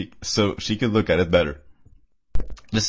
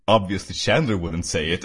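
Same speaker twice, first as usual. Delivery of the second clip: usual speaking voice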